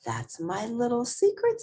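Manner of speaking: sweet, secretive teacher; coy teacher. A narrator's reading.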